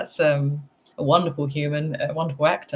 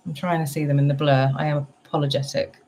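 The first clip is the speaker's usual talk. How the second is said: usual speaking voice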